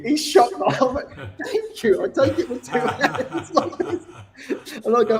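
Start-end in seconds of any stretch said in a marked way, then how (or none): 1.4-4.0 high, laughing "Thank you". Retold or imitated. Son thanking his dad for the cheque, laughing high. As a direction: giggle through the thanks.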